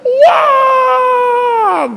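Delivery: the inner voice wailing stop; anguished cry. Retold or imitated. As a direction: plead desperately, stretching it. Inner voice's last plea.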